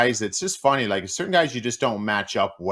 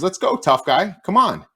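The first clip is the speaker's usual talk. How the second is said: louder, much higher mock challenge; taunting dare; 9 semitones higher, 6 dB louder than usual. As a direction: goad him to fight, half-shouting. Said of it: "and he goes".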